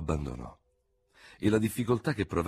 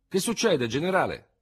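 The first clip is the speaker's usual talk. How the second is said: put-on high, loud anxious question from the old soldiers; anxious veterans; 11 semitones higher, 5 dB louder than usual. A narrator's reading. Old soldiers anxiously asking their general, high and loud. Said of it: "lo circondarono ansiose".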